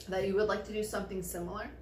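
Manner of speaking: ordinary talk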